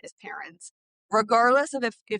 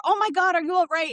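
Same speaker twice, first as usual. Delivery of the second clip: high, loud cry of alarm; alarmed mother; 8 semitones higher, 5 dB louder than usual. Retold or imitated. Mother sees ball hit her son, alarmed loud cry. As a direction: gasp it out like a startled mom. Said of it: "reaction as a mother".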